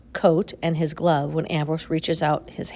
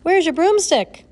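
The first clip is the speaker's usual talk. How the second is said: much higher, louder, a silly squeak; corny high question; 12 semitones higher, 8 dB louder than usual. A narrator's reading. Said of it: "something corny like".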